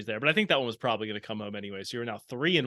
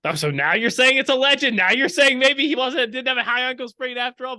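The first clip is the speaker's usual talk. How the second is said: high, loud mock outrage; mock indignation; 11 semitones higher, 7 dB louder than usual. Real, not acted. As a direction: call out the flip-flop. Host mocking co-host's backtracking.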